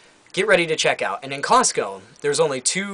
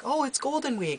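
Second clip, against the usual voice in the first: much higher, soft customer-service voice; card company operator excusing herself, much higher and softer; mock phone rep voice; 13 semitones higher, 6 dB quieter than usual. Card company rep quoted by caller.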